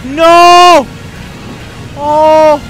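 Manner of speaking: long, high, loud wail; anguished shout. Real, not acted. Gamer watching the run go wrong, anguished shout. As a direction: cry out as it all goes wrong. Losing in the game.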